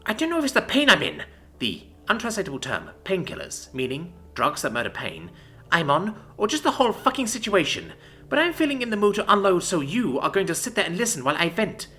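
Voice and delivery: fury. A narrator's reading. Wounded commander vents her fury at the enemy envoy. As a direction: unload all the rage. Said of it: "The mass of fury".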